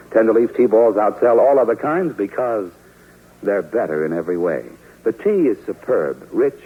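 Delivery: ordinary talk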